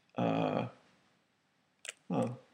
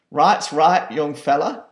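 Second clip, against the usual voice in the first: indignant mother; 5 dB louder than usual. Acted. Mother protests to the manager.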